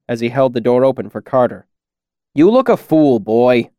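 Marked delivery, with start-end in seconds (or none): 2.3-3.7 grouse at the lad; grousing. A narrator's reading. Carter scolds the smith's prentice at the door, grousing. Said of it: "Carter groused".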